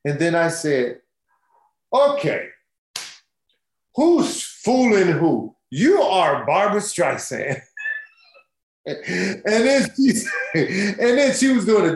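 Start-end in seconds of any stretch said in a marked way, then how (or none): 1.9-7.6 higher and louder, slowed right down in awe; can't believe who's in front of you; excited, disbelieving retelling; starstruck disbelief. Retold or imitated. Retells meeting [name].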